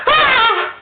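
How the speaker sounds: high strained cry out; high wail. Retold or imitated. Pharaoh's inner spirit crying, high mock wail. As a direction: let out a shrill mock wail.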